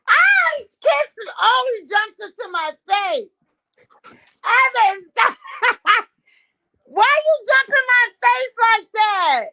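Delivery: high, loud laughing shriek; laughing squeal. Real, not acted.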